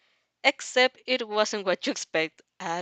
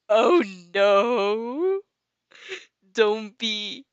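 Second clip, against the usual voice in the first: breaks up laughing mid-read; loud giggling yelp at the scene; laughing cry; 6 dB louder, much slower than usual. Real, not acted. Narrator cracking up at her own story, laughing yelp.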